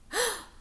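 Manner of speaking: high sharp gasp of alarm; a big theatrical gasp; mock gasp. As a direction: gasp in pretend fright. Reacts to Miss Swamp coming.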